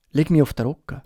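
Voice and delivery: ordinary delivery, nothing marked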